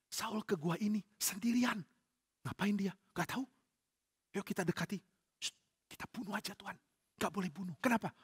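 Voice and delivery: hushed, soft conspirators' voices; whispering. Acted. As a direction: whisper so the enemy won't hear. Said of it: "kenapa kita bisik-bisik?".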